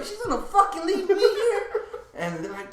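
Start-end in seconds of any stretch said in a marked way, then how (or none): 0.0-1.6 high, laughing mock disbelief; retold indignant outrage. Drunk man complaining to friends about being left, indignant.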